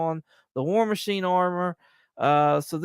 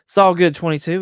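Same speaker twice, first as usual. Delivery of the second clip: normal talking voice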